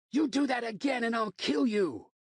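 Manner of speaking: high, loud threatening scream; furious scream. Acted. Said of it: "I screamed at Will".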